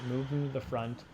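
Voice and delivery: ordinary talk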